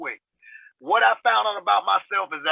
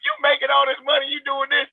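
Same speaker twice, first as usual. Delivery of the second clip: higher and faster, imitating an exasperated mentor; aggravated mentor; 9 semitones higher than usual. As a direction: rattle it off like the fed-up mentor. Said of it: "I could tell he was aggravated".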